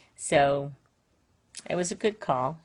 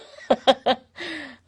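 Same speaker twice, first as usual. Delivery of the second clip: a high burst of real laughter; 10 semitones higher, 5 dB louder than usual. Real, not acted. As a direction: crack up at the punchline. Grandma cracking up at the story.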